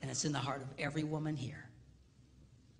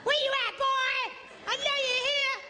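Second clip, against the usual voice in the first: calling through the jungle; 8 dB louder than usual. Retold or imitated. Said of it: "she didn't have to go running through the jungle going".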